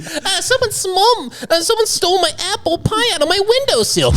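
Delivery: mock old lady complaint. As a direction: squeak like a fussy townswoman. Acting Mayberry complaint.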